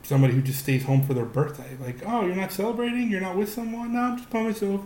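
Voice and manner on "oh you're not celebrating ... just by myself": high-pitched imitation of offhand small talk; breezy, mock-casual chat. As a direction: act out a casual exchange, voice well up. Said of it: "it's very casual".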